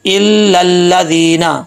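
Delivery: "इल लल्लादीना" slowly with the first l doubled; correct recitation. Shown as a demonstration. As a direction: hold the ल and long vowels of "इल लल्लादीना". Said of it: "तो कैसे पढ़ेंगे". ("इल लल्लादीना" में पहला लाम दोहराकर धीरे पढ़ें)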